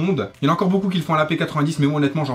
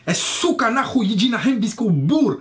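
much higher, slow mock Russian babble; mock Russian gibberish; 8 semitones higher, slower than usual. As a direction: fake Russian gibberish, hammed up. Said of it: "tu te prends des".

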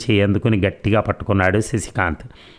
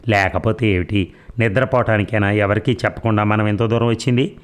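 plain reading voice

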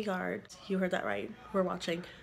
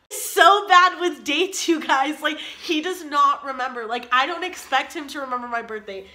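loud, higher-pitched outburst; exasperated teasing; 5 semitones higher, 7 dB louder than usual. Real, not acted. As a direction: burst out loudly, teasing your partner. Teasing outburst about partner forgetting dates.

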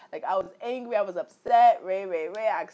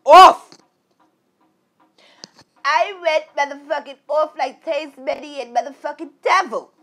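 loud, high burst on the word; punched loud; 7 semitones higher, 13 dB louder than usual. Real, not acted. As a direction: blast the word out. Recalling explosive anger.

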